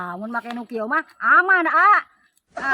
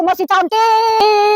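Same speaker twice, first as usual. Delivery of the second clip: put-on coy old granny; 8 semitones higher, 4 dB louder, slower than usual. Granny insists she's pretty.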